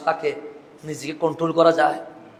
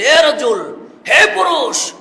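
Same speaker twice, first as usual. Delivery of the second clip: loud, high hail to a man; calling out; 10 semitones higher, 10 dB louder, much slower than usual. Acted. Preacher voices a summons to a man, calling out loudly. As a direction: call out sharply across a distance.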